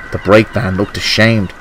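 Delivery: usual speaking voice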